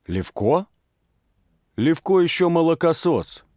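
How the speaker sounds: gruff man scoffing, lightly voiced; a hint of a hoarse older man; hoarse half-voice. A narrator's reading. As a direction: sneer at the boy in a rough undertone. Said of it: "говорил хрипло и вполголоса".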